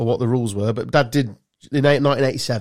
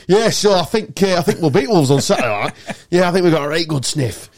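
higher Yorkshire football banter; hammed-up Yorkshire lad, laughing; northern accent; 5 semitones higher, 4 dB louder than usual. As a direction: lay the Yorkshire on thick about Leeds. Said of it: "So I just suddenly went northern".

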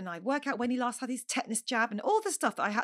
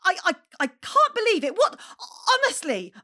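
much higher and louder, ranting at her son; hormonal rage outburst; 9 semitones higher, 8 dB louder than usual. Retold or imitated. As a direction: let the rage spill over, fast and shrill with disbelief. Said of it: "horrific hormonal outburst".

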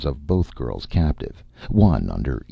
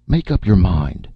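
loud, tart demand; 5 semitones higher, 7 dB louder than usual. A narrator's reading. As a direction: snap it out impatiently. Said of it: "Erika said tartly".

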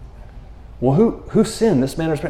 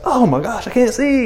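mock pained cry; 8 semitones higher than usual. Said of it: "till you're just like".

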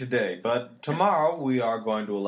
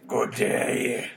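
gruff man. Acted. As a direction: growl it like a gruff old sailor. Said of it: "now you sound like, uh, you're a gruff man".

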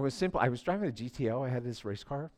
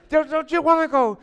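ordinary talk, nothing marked

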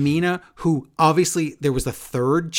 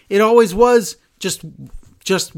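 louder and higher, cold and cutting; heel declaration; 4 semitones higher, 6 dB louder than usual. Retold or imitated. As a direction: announce who is better. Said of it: "'cause Nikki goes".

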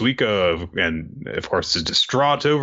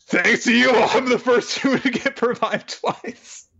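laughing; 13 semitones higher than usual. Retold or imitated. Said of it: "and he's like".

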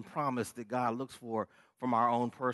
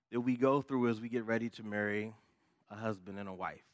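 ordinary talk

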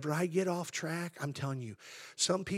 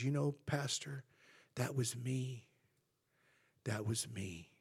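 subdued, softer imitation of a moved woman; retold tearful confession; 7 dB quieter than usual. Saved lady confessing to her pastor, retold quietly. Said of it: "tears streaming down her face".

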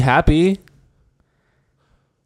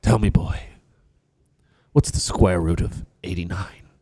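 low, softer stern father voice; 5 semitones lower, 6 dB quieter than usual. Pretend. A cold father quizzes his son on math, low and soft. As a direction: quiz the boy like a cold dad.

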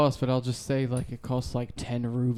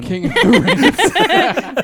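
laughing; 13 semitones higher, 8 dB louder, much slower than usual. Real, not acted. Laughing mock title.

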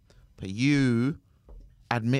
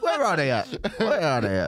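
laughing; 5 semitones higher, 3 dB louder than usual. Retold or imitated. Laughing echo of the shout-out.

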